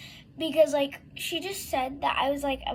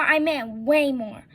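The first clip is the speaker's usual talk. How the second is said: slow, loud stress on way more; 5 dB louder than usual. Real, not acted. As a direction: lean hard and slow on way more. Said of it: "And when I say way more".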